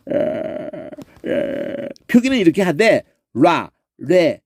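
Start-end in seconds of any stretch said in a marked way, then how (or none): 0.1-1.9 throat rattle demo. Shown as a demonstration. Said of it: "이렇게 발음한다".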